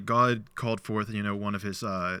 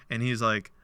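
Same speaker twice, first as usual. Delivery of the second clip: ordinary talk, nothing marked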